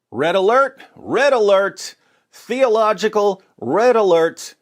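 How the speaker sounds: loud, rising mock alarm siren call. Sounding an alarm over Jesus' claim.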